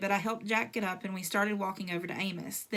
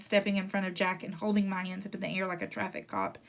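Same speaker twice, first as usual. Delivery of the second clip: ordinary delivery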